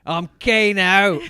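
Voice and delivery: slow, loud Australian Kano voice; mock Australian villain accent. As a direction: growl it out as a hammed-up Australian. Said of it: "Australian accent".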